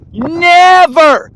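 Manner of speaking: never shouted out loud and high; one-word outburst. Real, not acted. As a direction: bark out a sudden emphatic never. Ranting emphasis on never.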